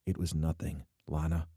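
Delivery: soft, low murmur; quiet, downplaying reply; said quietly. A narrator's reading. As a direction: quietly brush it off. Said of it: "he said quietly".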